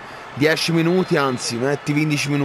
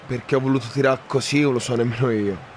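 ordinary delivery

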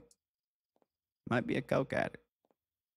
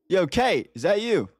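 calling out; 7 semitones higher, 3 dB louder than usual. Real, not acted. Calling out for Kate.

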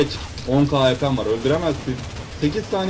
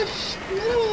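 squeaky taunt; 4 dB quieter than usual. Acted. Taunting players as a zombie.

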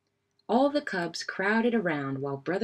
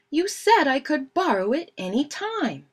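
high, louder protest; defensive protest; 9 semitones higher, 5 dB louder than usual. A narrator's reading. Fred defending himself to Brother, defensive. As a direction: argue back in a raised pitch.

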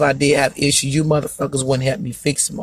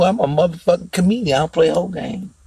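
ordinary talk

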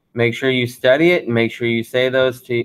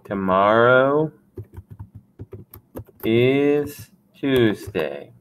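slow repeat; slower than usual. Shown as a demonstration. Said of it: "martes in this case".

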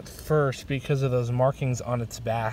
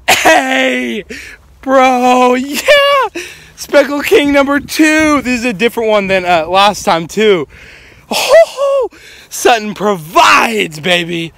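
excited shout; 10 semitones higher, 11 dB louder, slower than usual. Real, not acted. Finding a rare snake, yelling.

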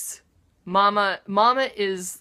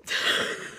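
breathy sob-laugh; 4 dB quieter than usual. Real, not acted. Crying laugh.

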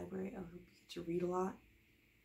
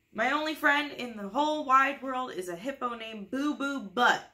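higher and louder, proud and goofy; hammed-up loud proud declaration; silly announcement; 6 semitones higher, 8 dB louder than usual. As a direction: boast it like a child showing off. Reading the book's silly line.

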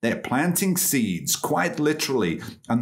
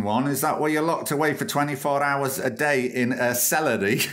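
ordinary talk, nothing marked

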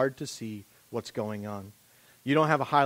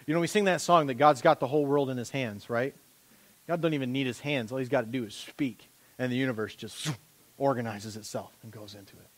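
ordinary delivery

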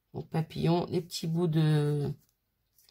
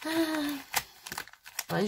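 ordinary talk, nothing marked

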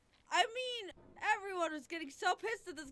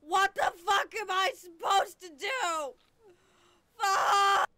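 loud, higher yelling; furious shout; 6 semitones higher, 10 dB louder than usual. Real, not acted. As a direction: scream it at the game. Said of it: "just by screaming at this game".

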